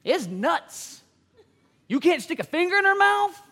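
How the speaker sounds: normal talking voice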